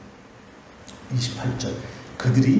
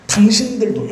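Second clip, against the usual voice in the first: 당신들도 said loud and raised; stress on 당신들도; 6 semitones higher, 6 dB louder than usual. Shown as a demonstration. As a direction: punch 당신들도 as the key word. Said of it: "카이 휴메이스라는 말은". (당신들도를 크게 힘주어)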